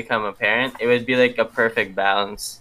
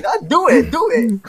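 taunting; 4 dB louder than usual. Retold or imitated. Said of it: "I'm like".

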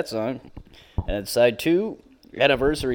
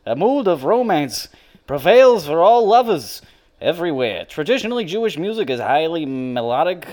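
mock liner-note read; 4 dB louder than usual. Reading the record sleeve to his podcast friends, mock-grand announcer voice.

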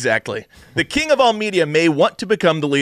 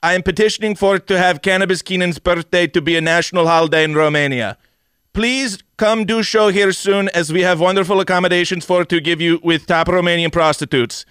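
put-on Romanian accent; 2 semitones higher than usual. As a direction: read the fan letter in a hammed-up Romanian accent. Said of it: "try to do it in his accent".

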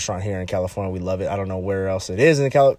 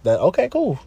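higher, mocking "okay, cool"; 8 semitones higher than usual. Shown as a demonstration. Mocking the guys who say it don't work, higher and teasing. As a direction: toss "cool" back with a mocking lilt. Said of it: "I'm the scientist over here".

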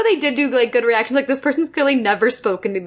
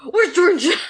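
imitating a yell; 5 semitones higher than usual. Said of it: "no one else is yelling".

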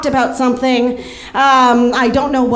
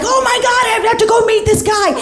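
put-on drunk panic; 10 semitones higher than usual. Drunk panic about the date.

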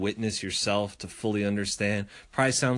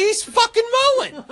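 mock squeal; 6 dB louder than usual. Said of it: "I'm like".

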